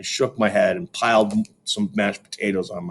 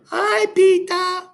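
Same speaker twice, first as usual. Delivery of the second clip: put-on high, drawn-out child greeting; a small boy sing-songing hello; little kid voice; 4 dB louder, much slower than usual. A narrator's reading. Little brother greeting Peter, high childish voice.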